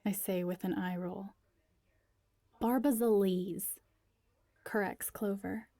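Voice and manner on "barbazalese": lightly put-on Barbazalian accent, drawn out; a hint of a Barbazalian lilt, slow. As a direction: correct them in a mild Barbazalian accent. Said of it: "picked up a Barbazalian accent".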